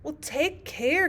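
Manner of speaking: high, drawn-out alarmed warning; crying out warning. A narrator's reading. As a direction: warn the Queen urgently. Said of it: "cried Alice".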